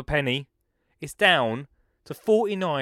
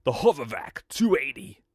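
trying to sound cool. Shown as a demonstration. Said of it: "I don't know how to say it cooler".

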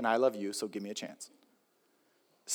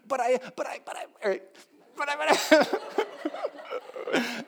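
put-on much higher protesting kid; whiny child; 11 semitones higher than usual. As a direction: stammer like a caught child.

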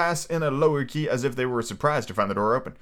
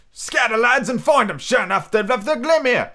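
high, raised crew voice; calling from window; 9 semitones higher, 4 dB louder than usual. A narrator's reading. Fellow at the window rallying the lads, calling out. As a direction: call the lads out, pitched high.

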